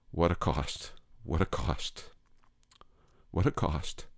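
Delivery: low, soft, sorrowful refrain; hushed lament. Acted. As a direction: let each repeat drop low and quiet, with weight. Grieving reflection after the tragedy.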